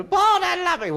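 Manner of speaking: high, loud mock girl's plea; hammed-up lovesick girl; swooning girl wailing for Paul; girl crying for Paul. Girls crying on his shoulder.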